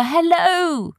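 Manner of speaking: high, loud hail; eager ringing shout. A narrator's reading. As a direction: call out to get noticed far away. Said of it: "shouted Arthur".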